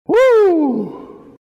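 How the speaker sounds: high joyful woo; delighted whoop. Real, not acted. Preacher thrilled by the verse, whooping. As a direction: let out a happy shout.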